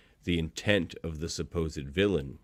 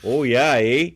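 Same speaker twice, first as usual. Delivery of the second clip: loud, higher mock Minnesota accent; hammed-up Fargo "oh yeah"; 7 semitones higher, 9 dB louder, slower than usual. Cohost mocking how Serenity hears them, Fargo accent. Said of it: "sound like we're from s-, Fargo".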